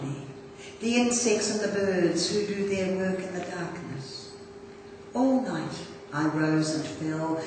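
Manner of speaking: lifted, projected poem reading that sinks at the close; poetry recitation. Acted. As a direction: read it out as a poem, phrase by measured phrase. Reads a Mary Oliver poem.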